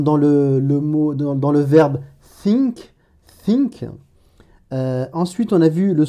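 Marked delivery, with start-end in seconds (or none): 2.4-2.9 « think » avec le th anglais, ton montant. Shown as a demonstration. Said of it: "ça correspond au T H anglais". 3.4-3.9 « think » répété avec le th anglais, ton descendant. Shown as a demonstration. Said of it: "ça correspond au T H anglais".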